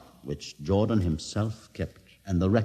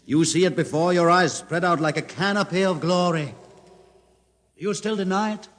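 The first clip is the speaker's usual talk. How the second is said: triumphant reveal; 9 semitones higher than usual. Acted.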